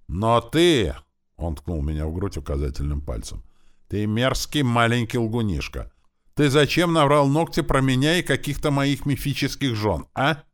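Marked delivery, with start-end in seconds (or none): 0.1-0.9 high, loud accusation; accusing. A narrator's reading. Orc poking the boy in the chest, accusing. 3.9-10.4 high, loud scolding; angry accusation. A narrator's reading. Orc to boy who told tales about him, angry accusation.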